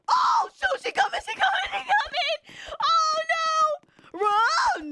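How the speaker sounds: very high, loud frantic squeal; shrieking alarm; panicked shriek. Real, not acted. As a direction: scream in panic as she closes in. Killer is coming at player.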